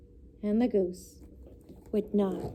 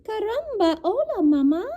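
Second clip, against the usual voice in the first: much higher tiny chick voice; baby chick greeting; 10 semitones higher, 5 dB louder than usual. A narrator's reading. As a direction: coo it like a newborn chick. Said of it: "looked up at Jack and said".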